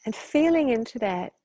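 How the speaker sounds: measured meditation questions, each opening lifted, with long pauses; guided meditation. Real, not acted. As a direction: invite reflection, spacing out each phrase.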